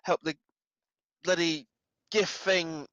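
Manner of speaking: high, loud, pained orders; strained urging. Acted. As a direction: bark them out while hurting. Character pained, ordering guards out.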